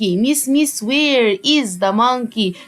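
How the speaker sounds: where is the monkey said very slowly; slow, spelled-out answer. Shown as a demonstration. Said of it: "لأن هو بيسأل على قرد واحد".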